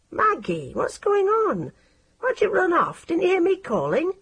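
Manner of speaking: lightly voiced lad checking on her; concerned boy Nick. A narrator's reading. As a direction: friend catching up after running.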